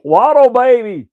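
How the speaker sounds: loud, high fan cheer; excited cheer. Real, not acted. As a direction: whoop it out like a proud fan. Fan cheers player's name.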